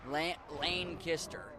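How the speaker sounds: "Lane kissed her" slowly, each word separate; slow sounding-out. Shown as a demonstration. Streamer testing a pronunciation tip, slow sounding-out. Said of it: "but very fast".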